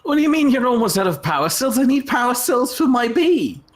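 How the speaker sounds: indignant. Real, not acted. Outraged reply about power cells.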